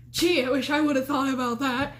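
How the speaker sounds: mock gratitude, pointed; sarcastic. Girlfriend mocking the advice to breathe, sarcastic. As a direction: feign a sudden revelation, sarcastically.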